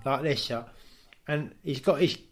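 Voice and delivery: ordinary talk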